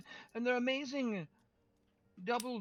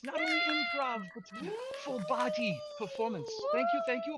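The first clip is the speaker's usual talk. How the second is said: much higher, bragging stage voice; squeaky show-off performer. Pretend. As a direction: preen like a proud little bard taking a bow. Player boasts in character voice.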